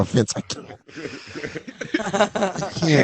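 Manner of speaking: soft breathy refusal; almost whispered can't; quiet pleading. Pretend. Refusing Vince in skit.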